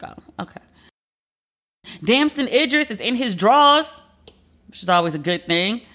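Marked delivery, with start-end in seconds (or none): 0.0-0.6 tiny, near-whispered acceptance; very soft, voiceless mock-meek answer; meek retold reply. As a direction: murmur it as if quietly backing down.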